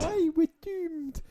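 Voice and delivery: high, slow echoing wail; mock doom-monger. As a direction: draw it out in a high put-on voice. Echoes the other's wail of doom.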